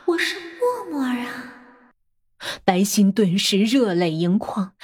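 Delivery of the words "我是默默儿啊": soft, sighing and disappointed; wistful sigh. A narrator's reading. The ghost sighs her name to the forgetful boss, softly. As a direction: let it drift out on a sigh. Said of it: "她悠悠地叹了口气".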